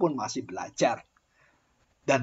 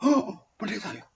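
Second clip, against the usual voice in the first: mock surprised recoil; 7 semitones higher than usual. A man recoiling on learning the speaker is a pastor, mock surprised. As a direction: play the man pulling away in shock.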